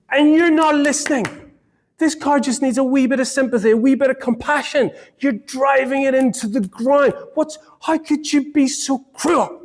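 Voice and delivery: high, hammed-up pleading for the car; husband begging his wife to pity the car, mock pleading. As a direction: plead theatrically on the car's behalf in a high voice. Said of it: "I'm like".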